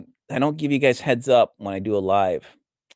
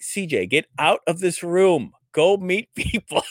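higher-pitched mock ordering; imitating jocks; 8 semitones higher than usual. Brother's friends ordering CJ out to meet people, mock insistence. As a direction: play the jock pushing him out, breaking into a laugh. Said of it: "They'd be like".

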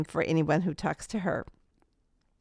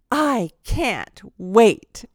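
very slow, drawn-out and louder; radiant anticipation; 4 semitones higher, 6 dB louder, much slower than usual. Retold or imitated. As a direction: savor each word, quoting her through a smile. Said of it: "she had the most radiant smile".

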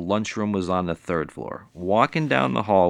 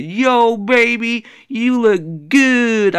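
high, loud, slow drawn-out pickup line; catcalling Puerto Rican guy; 13 semitones higher, 7 dB louder, much slower than usual. Retold or imitated. Man in stairwell hitting on a lady, slow loud catcall. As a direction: lay on the smooth catcall. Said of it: "I said the same line that the Puerto Rican guy did".

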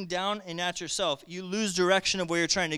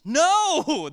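higher, louder shout of no; emphatic no; 9 semitones higher, 8 dB louder than usual. Real, not acted. Preacher to congregation, rejecting procrastination with a loud no. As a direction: slam the door on that idea.

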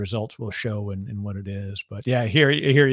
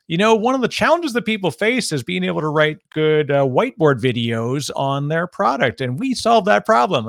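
higher, louder imitation of a pushy pitch; lightly put-on eager salesperson patter; mock sales pitch; 5 semitones higher, 5 dB louder than usual. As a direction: imitate a canned pitch with lifted pitch.

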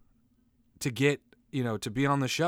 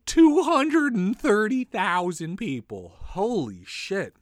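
mock shock; 7 semitones higher, 3 dB louder, slower than usual. Real, not acted. Shocked at death toll.

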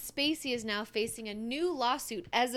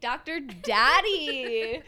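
slow, loud drawn-out tease; 2 semitones higher, 6 dB louder, much slower than usual. Pretend. Echoes nickname louder, slower.

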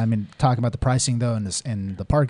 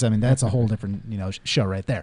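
ordinary talk, nothing marked

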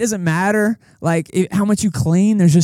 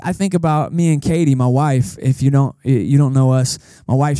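ordinary talk, nothing marked

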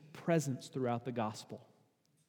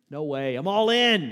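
boastful brag; 2 semitones higher, 8 dB louder than usual. Retold or imitated. Peter bragging to the disciples, cocky and loud. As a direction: puff up and declare you're all in.

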